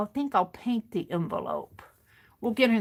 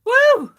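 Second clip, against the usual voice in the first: loud whoop; 14 semitones higher, 12 dB louder than usual. Real, not acted. Recovering from choking fit.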